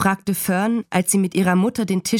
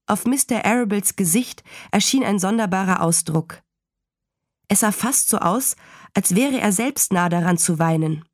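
usual speaking voice

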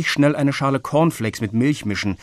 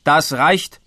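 louder, stern cut-off; firm dad; 5 semitones higher, 6 dB louder than usual. A narrator's reading. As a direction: put an end to the quarrel. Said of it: "mischte sich Herr Meyerbeer ein". (Vater greift streng ein)

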